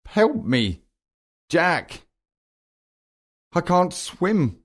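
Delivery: high-pitched cry for help; urgent narrated cry, voice lifted high. A narrator's reading. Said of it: "cries Bill".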